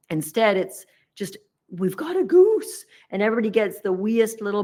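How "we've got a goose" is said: proud excited exclaim. Retold or imitated. Cratchits proudly announce their goose.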